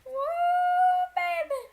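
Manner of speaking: high whooping cheer; woo cheer. Real, not acted. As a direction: whoop like at a party. Cheering new weapon.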